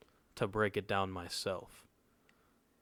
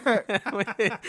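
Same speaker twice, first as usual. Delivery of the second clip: high burst of laughter; loud laugh; 14 semitones higher, 13 dB louder than usual. Real, not acted. Teen laughing at his own leaf blower story.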